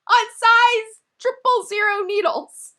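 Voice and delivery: high, slow, laughing disbelief; exasperated punchline. Real, not acted. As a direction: stretch it out like it's absurd.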